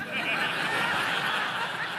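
soft unvoiced chuckle; quiet laugh under the breath; breathy laugh. Real, not acted. Laughs at his own prayer.

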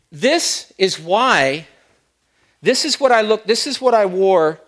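loud, slow emphatic preaching; booming pulpit emphasis; preaching shout. Real, not acted. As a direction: drive it home at full volume. Pastor showing old shirt, shouting.

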